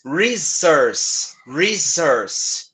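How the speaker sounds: "resource" with curled, growled Rs. Shown as a demonstration. Teacher drilling students on the Rs in "resource". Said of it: "dois rosnados aí na mesma palavra".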